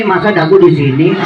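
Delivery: ordinary delivery, nothing marked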